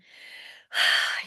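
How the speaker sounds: big breathy exhale, let it all go; loud release sigh. Shown as a demonstration. Teacher showing the group how to exhale, loud sigh. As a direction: sigh out the tension loudly. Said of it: "You gotta shake it out".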